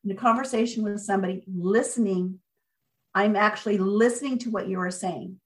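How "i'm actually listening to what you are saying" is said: ordinary talk, nothing marked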